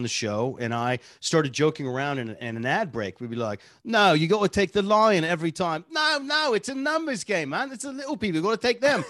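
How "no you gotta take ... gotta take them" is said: put-on high, animated English accent. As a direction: argue like two English blokes over a bar bet. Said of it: "it started in England as a bar bet".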